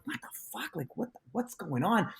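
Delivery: whispered disbelief, then a hushed question; baffled aside under the breath; retold private bewilderment, very soft; stunned whisper. Stunned private reaction during his show.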